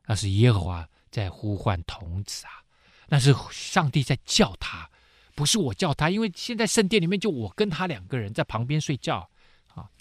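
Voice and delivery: usual speaking voice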